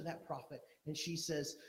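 ordinary talk

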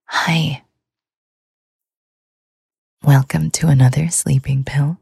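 ordinary talk, nothing marked

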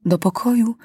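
ordinary delivery, nothing marked

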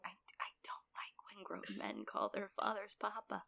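unvoiced whispered aside; whispered disapproval of grown men saying Papa. Real, not acted. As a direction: whisper it like a confession.

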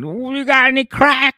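high, loud, pleading junkie voice; strung-out Cindy. Retold or imitated. Cindy begging motel neighbours, high and strung out. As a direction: beg for crack in a high strung-out voice. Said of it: "She was in there going".

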